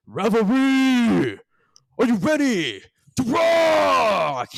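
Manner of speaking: high, slow arena shout; rock star. Acted. Bard opening his concert, slow high rock shout. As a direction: scream it to the crowd like a frontman. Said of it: "he goes".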